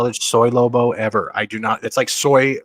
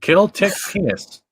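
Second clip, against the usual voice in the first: backwards speech; 9 semitones higher than usual. Shown as a demonstration. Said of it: "And backwards".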